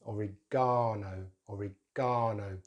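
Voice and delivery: slow oregano, long stressed GAH: o-ri-GAH-no; stress on third syllable. Shown as a demonstration. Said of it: "sorry, third syllable".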